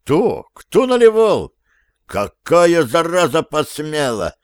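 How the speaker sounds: high, loud, outraged questions; indignant outcry. A narrator's reading. Steve's team protests in chorus, indignant. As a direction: burst out in indignant disbelief. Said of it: "Дружно начала возмущаться команда".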